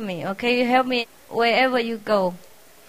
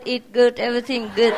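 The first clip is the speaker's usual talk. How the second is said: ordinary talk, nothing marked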